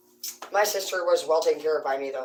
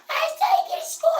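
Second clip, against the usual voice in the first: very high, tiny frightened voice; scared little sister. Retold or imitated. Terrified sister giving in to the threat, high and scared. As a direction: squeak out the surrender. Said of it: "She's like".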